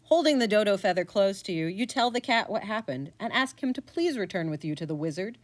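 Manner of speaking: ordinary delivery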